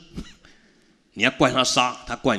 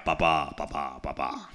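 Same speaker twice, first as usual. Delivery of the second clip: 低沉地轻唤爸爸，逐渐收声; 一个跪着的女儿，哀伤地低声呼唤; lightly voiced grieving daughter; 6 semitones lower, 6 dB quieter than usual. Daughter kneels calling her father. (女儿跪着呼唤转世的爸爸，低声哀伤)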